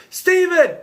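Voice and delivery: exaggerated high, loud yell; hammed-up shout of the name; William Baldwin yelling. Reviewer acting out the joke edit, hammed-up yell. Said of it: "William Baldwin yelling out".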